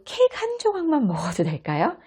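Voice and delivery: put-on high, sweet little child asking. Child asks mom for cake, high childlike voice. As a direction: play the child begging for a slice of cake.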